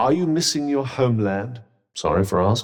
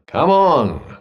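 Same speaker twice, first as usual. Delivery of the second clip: louder, drawn-out mock cheering-on; token encouragement; 5 dB louder, slower than usual. Vaguely supporting a struggling companion, token encouragement. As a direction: cheer them on with hollow support. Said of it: "and other vaguely supportive statements".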